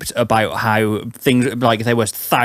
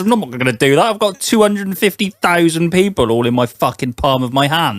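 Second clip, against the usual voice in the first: mock [name]; 8 semitones higher than usual. S1 imitating the singer bragging, raised cocky voice. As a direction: brag like a smug rock star.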